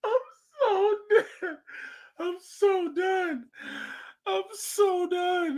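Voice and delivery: high, slow words squeezed out through laughter; wheezing with real laughter, barely getting it out; crying with laughter, stretched high and slow; laughing. Real, not acted. Helpless laughter at clip.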